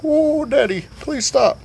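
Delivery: high-pitched mock plea to Daddy; pleading yelp. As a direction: beg in a high, put-on yelp.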